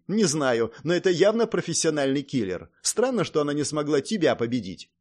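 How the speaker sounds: usual speaking voice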